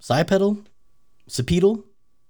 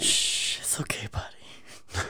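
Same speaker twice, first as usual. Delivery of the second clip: whispered, slow and soothing; cooing to a scared pet; 3 semitones lower, 12 dB quieter, much slower than usual. Pretend. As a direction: hush it gently like a spooked animal. Soothing the frightened red block.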